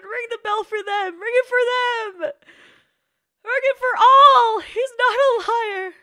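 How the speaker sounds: building to a high, loud cry; impassioned, voice rising with emotion; tearful cheering. Real, not acted. Crying out for the character.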